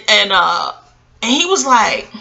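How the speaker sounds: usual speaking voice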